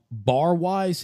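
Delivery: normal talking voice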